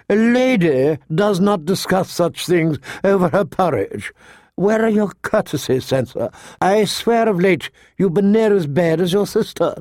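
high-pitched scolding governess voice; horror-struck septa. A narrator's reading. Septa rebukes her young charge over breakfast, horror-struck. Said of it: "The Septa was horror-struck".

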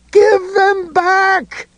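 high, drawn-out desperate scream. A narrator's reading. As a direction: wail it out, slow and high. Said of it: "Ed screamed".

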